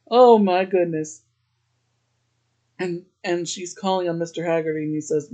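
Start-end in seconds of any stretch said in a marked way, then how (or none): 0.1-1.2 louder, breathy oh my goodness; half laughing at the scene; amused disbelief. Real, not acted. Vlogger reacting to the pickup line, amused disbelief.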